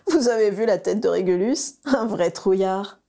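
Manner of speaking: teasing, slowed by giggles; mock through a giggle; giggling. A narrator's reading. Sirius mocks his cousin, giggling. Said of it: "gloussa Sirius".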